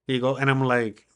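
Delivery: ordinary talk, nothing marked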